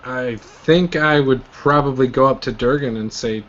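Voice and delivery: usual speaking voice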